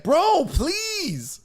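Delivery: high whiny "Bro, please"; mock plea. Mimics the man begging his attacker, high whiny mock plea. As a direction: beg like a scared guy. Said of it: "He's like".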